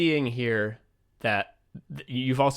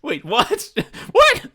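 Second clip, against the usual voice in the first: a much higher, louder laughing "What?"; laughing disbelief; 8 semitones higher, 4 dB louder, slower than usual. Real, not acted. Hearing Turandot is set in China, laughing incredulous squeak. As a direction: squeal it out in shocked amusement.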